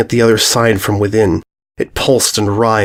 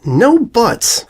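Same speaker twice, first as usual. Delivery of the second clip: high cutting filly voice; curt; 12 semitones higher than usual. Acted. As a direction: cut her off sharply. Bully cuts her off.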